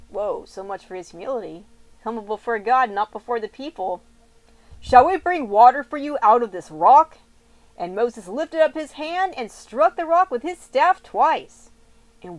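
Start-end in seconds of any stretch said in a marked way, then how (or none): 4.8-7.2 loud, furious shout at the crowd; angry rebuke. Acted. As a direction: blast it at the rebels. Moses scolds the assembly.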